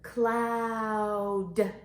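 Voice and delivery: CLOUD held long, final D set apart; long level vowel in Cloud, then D; stretched-out word. Shown as a demonstration. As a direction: lead a slow, stretched Cloud. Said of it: "Let's do it together".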